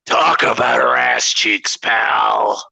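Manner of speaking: light impression of a wrestling boss in the announcer's ear; lightly put-on boss voice, measured delivery; Vince. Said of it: "it was Vince in there being like".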